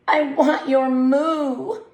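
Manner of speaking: pleading, slow and drawn out; begging. Acted. Toodles begging Kathy the cow, slow pleading. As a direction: beg for it. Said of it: "Toodles begged".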